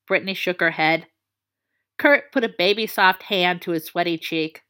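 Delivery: usual speaking voice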